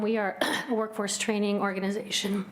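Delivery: usual speaking voice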